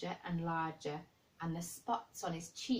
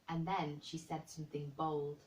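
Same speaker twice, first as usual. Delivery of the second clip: ordinary delivery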